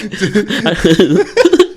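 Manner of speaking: loud high cackle; high laugh. Real, not acted. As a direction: howl with laughter. Laughing hard.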